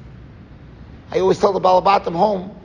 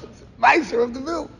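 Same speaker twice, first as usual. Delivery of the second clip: ordinary talk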